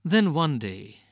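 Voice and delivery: ordinary delivery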